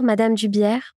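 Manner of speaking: plain reading voice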